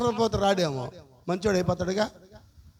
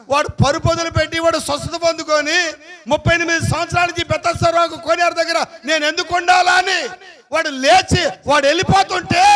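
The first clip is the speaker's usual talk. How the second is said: higher, louder, emphatic sermon voice; fervent preaching; 5 semitones higher, 6 dB louder than usual. Acted. Pastor telling the healing story to the church, fervent preaching.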